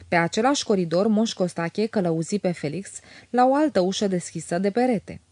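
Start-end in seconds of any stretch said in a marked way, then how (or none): none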